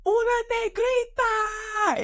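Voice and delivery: put-on high, drawn-out kid's shout; yelling little boy. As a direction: imitate a shocked boy yelling at full voice. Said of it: "yelled it at the top of his lungs".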